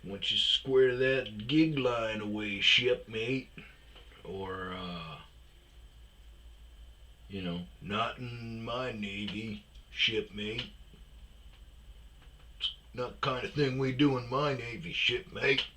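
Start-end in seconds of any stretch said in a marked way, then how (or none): 0.0-3.5 a hint of a belittling old sailor, a bit higher and louder; lightly mock a salty old petty officer; mock put-down to a junior sailor; salty Navy dog. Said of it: "it's more belittling". 7.8-15.7 mild mock scolding from a crusty veteran; gently imitating a condescending old sailor; salty Navy dog. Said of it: "older salty dogs".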